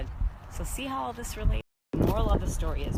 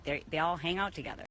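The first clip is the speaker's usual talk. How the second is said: normal talking voice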